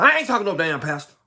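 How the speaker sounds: mild mock of a drunk, defiant father; defiant retort. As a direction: brush off the pastor quickly. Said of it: "My dad said".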